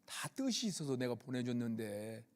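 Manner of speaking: low, soft voice lightly playing God; God gently chiding. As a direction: quietly imitate a fatherly reproof from God. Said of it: "하나님이 뭐라고 하시는 거예요".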